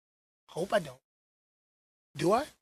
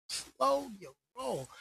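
slow down; 4 semitones higher, 9 dB quieter, slower than usual. Real, not acted. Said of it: "repeats slow your roll".

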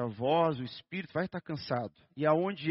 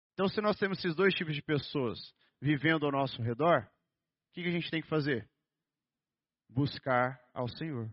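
normal talking voice

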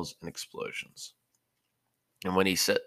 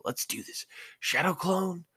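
much higher, charged-up call; psyching himself up; eager; 13 semitones higher than usual. Acted. Naruto calls his clone jutsu, eager and higher.

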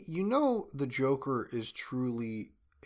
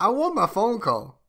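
lightly voiced Joker, a touch higher and louder; a hint of the Joker demanding his call; 9 semitones higher, 7 dB louder than usual. Friend showing how he'd play Joker, lightly voiced Joker. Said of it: "That's how I would be as Joker".

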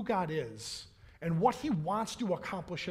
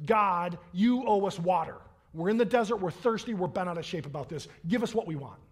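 ordinary talk

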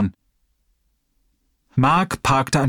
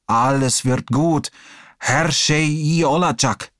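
put-on slow, soothing old man; gentle comfort; 2 semitones higher, slower than usual. Old man comforts frightened young Hassan, slow and soothing.

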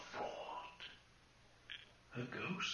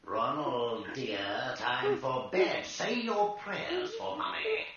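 louder sing-song bedtime mother; mocking mother; 3 semitones higher, 5 dB louder than usual. Ghost mimicking Mummy putting Ronald to bed. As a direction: coo it like a mother, cruelly.

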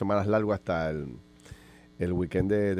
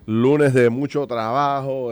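radio host opening; 6 semitones higher, 7 dB louder than usual. Acted.

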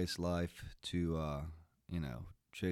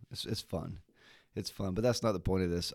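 normal talking voice

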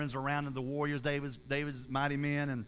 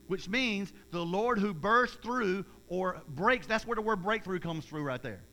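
normal talking voice

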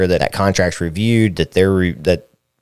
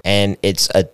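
ordinary talk, nothing marked

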